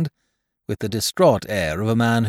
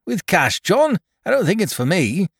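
"With cash" asked much higher and louder, baffled; puzzled Caleb; 13 semitones higher, 6 dB louder than usual. Acted. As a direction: squeak "With cash" in confusion. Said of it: "returned the carrier".

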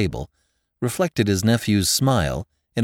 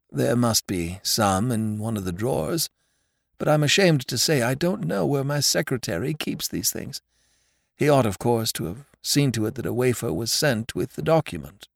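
put-on calm older host; 4 semitones higher than usual. Host speaks serenely.

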